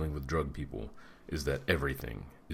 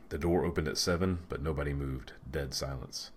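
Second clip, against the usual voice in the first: ordinary delivery, nothing marked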